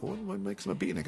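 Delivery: lightly acted bafflement; puzzled questioning. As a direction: ask it quickly, pitch lifted in doubt. Acting out own puzzled question.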